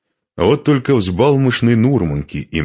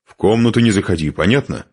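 brisk, louder, higher warning; angry; 5 semitones higher, 5 dB louder than usual. A narrator's reading. Man forbidding the woman to enter the room, angry. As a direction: fire off a cross order. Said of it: "сердито сказал он".

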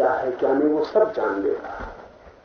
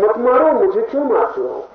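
higher, louder mock outcry of protest; imitated cry; 8 semitones higher, 5 dB louder than usual. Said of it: "Ramakrishna चिल्लाते हैं". (चिल्लाने की नकल)

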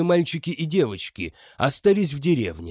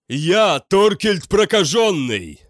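loud, slow, echoing proclamation; booming voice; 4 semitones higher, 5 dB louder, slower than usual. A narrator's reading. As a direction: boom it like an undead warrior. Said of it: "заговорил как можно более гулким голосом".

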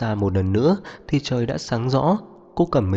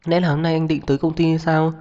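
higher-pitched, hopeful question; slightly expectant; 7 semitones higher than usual. A narrator's reading. Woman asking her partner about the office, hopeful. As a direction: ask with a touch of expectation in a raised pitch. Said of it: "giọng điệu có chút chờ đợi".

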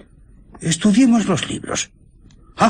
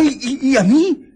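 frightened cry; 5 semitones higher than usual. Acted. Gripped by the invisible man.

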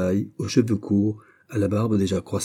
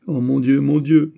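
higher, louder anguished outcry; despairing cry; 5 semitones higher, 6 dB louder than usual. A narrator's reading. Prisoner collapses before the governor, despairing cry. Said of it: "s'écria le jeune homme".

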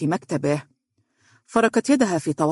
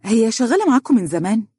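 curious; 6 semitones higher, 4 dB louder than usual. A narrator's reading. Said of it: "قالت باهتمام".

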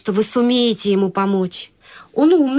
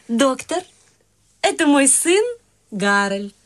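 ordinary talk, nothing marked

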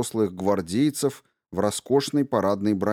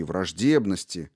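plain reading voice

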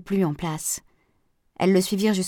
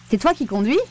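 mildly anxious, higher-pitched query; worried question; 4 semitones higher than usual. A narrator's reading. Aurore worried to find Pascal flying, mildly anxious. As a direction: ask it with rising concern. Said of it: "s'inquiéta Aurore".

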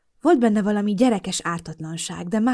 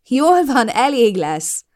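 laughing push-off, louder and higher; 6 semitones higher, 8 dB louder, much slower than usual. A narrator's reading. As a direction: laughingly tell him enough. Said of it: "toltam el magamtól nevetve".

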